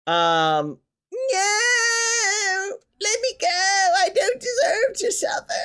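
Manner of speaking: mock captured cry. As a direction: wail like a captured damsel. Character captured in game.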